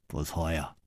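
ordinary delivery, nothing marked

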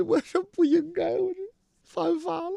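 put-on a high, whiny, tearful drunk's voice; mock crying. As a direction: imitate a sobbing drunk confessing. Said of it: "哭的". (学醉汉哭腔，声音高)

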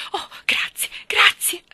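high, gushing, breathless thanks; relieved. A narrator's reading. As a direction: burst out with desperate gratitude. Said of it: "diede un sospiro di sollievo". (sollievo esultante, voce acuta)

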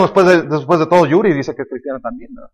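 low, quiet throwaway remark; offhand aside. Real, not acted. Preacher slipping in a celebrity example, low muttered aside. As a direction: drop well down in pitch and volume.

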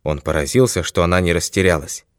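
usual speaking voice

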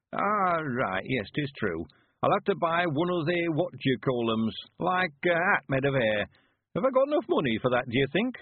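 put-on high rustic old-timer puzzling it out; high old man's dialect voice; old country man, earnestly fretting. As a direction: play an old villager fussing over his looks. Narrator plays old Mister Weevil.

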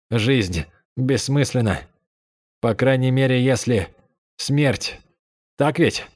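a boy's lonely self-mocking monologue; faint self-mocking voice. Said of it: "В еле слышном голосе мальчика задержалась насмешка над самим собой". (еле слышный монолог с насмешкой над собой)

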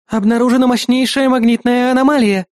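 high-pitched female AI warning; Eris warning. A narrator's reading. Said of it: "предупредила Эрис".

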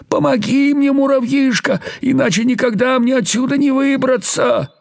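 high, begging voice; pitiful pleading. Acted. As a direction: plead like a frightened little creature. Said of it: "умолять жалобным голосом". (жалобно умоляет высоким голосом)

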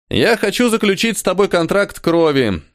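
high grinning offer; smiling. A narrator's reading. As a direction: say it with a wide grin. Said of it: "широко улыбнулся Кхали".

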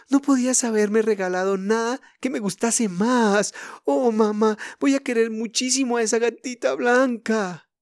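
put-on high, breathless girl's voice, bubbling with joy; surprised and delighted. A narrator's reading. Elsie thanking her mother for the kitten: high, overjoyed. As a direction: gush over the best present ever. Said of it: "completamente sorprendida y encantada".

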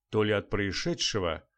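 plain reading voice

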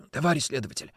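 hoarse whisper into the receiver; husky, hushed phone voice. Acted. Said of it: "прошептал в трубку хриплый голос". (хриплый шёпот в трубку)

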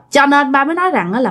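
usual speaking voice

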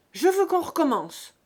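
high, very loud insistence; defiant, shouted demand; angry demand. A narrator's reading. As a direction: shout it back stubbornly. Buteau shouts his demand back.

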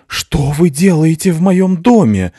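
high, loud, slow furious shout; shouting man. A narrator's reading. Angry father shouts at the intruders, loud and high. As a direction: yell at the intruders in his house. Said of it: "закричал мужчина".

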